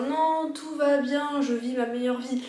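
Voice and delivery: sing-song fake positivity; fake cheerful. Mocking someone who denies their sadness.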